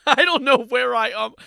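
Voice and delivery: high, loud mock wail; loud, amused mimicry; mimicking lost kid. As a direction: shout it like a hammed-up bewildered kid. Mimics the clip kid's lost line.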